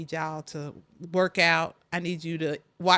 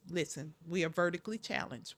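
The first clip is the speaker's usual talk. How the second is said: quiet aside; 4 semitones lower, 8 dB quieter, faster than usual. Shown as a demonstration. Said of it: "We're not short".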